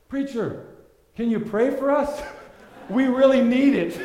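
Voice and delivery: high, loud, slow yelled plea, laughing at the end; mock yell. Fleeing mugger calling back to the preacher, mock yell. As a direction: yell it back over your shoulder as you run. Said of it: "he yells".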